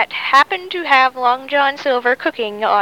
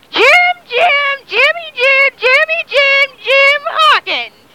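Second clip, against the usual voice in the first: high, loud singsong name chant; 11 semitones higher, 4 dB louder, slower than usual. Acted. Boy singsongs his own name.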